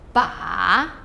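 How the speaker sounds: "ba" dipping low, then rising; third tone. Shown as a demonstration. Teacher practising syllables in four tones, "ba" falling then rising. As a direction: sink "ba" down, then scoop it up. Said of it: "in each of the four".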